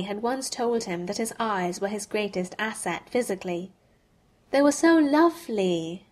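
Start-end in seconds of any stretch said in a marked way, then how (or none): 4.5-6.1 higher, louder gushing exclamation; exclaiming. Retold or imitated. Hermione praising Harry's eyes, exclaiming brightly. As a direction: gush over it like a chummy friend. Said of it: "she'd exclaimed one day".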